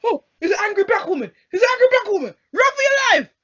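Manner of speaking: high, loud mock panic; hammed-up alarm; mock panicked woman. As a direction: ham up a frightened onlooker. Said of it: "And then she's like".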